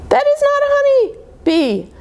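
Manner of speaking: high cartoon voice from the book; squeaky character voice. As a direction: pipe it up like a small storybook critter.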